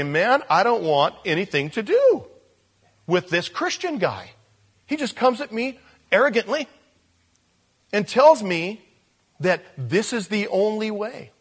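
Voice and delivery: acted indignation. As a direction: play the put-off listener protesting. Acted offended listener quoting himself.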